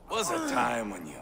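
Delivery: building from a growl to a shouted rant; furious rant. Acted. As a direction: work up into a rage and roar the end. Ivar rants at the spies.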